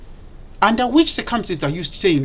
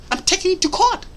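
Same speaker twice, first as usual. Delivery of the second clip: mocking quote; 12 semitones higher than usual. Mocking repeat of announcement.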